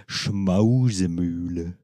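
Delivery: slow mimicked pronunciation; mimicking him. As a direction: say it slowly like him. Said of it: "So wird er das, glaub ich, sagen".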